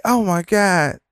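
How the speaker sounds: high, gushing imitation of a starstruck fan; mock a giddy woman cooing over a hat; high, gushing impression of the McDonald's lady swooning over a Trump hat. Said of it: "And so she say".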